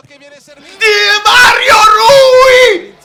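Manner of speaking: slow, high, loud goal call; stretched goal scream. Real, not acted. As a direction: drag out the name in a scream.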